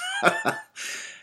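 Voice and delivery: high bursting laugh. Acted. Father cracking up at his pun, high laugh.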